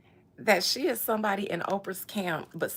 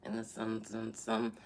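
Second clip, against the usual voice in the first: low mumble; 11 semitones lower, 10 dB quieter, slower than usual. Pretend.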